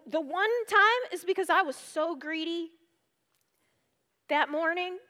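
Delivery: ordinary talk, nothing marked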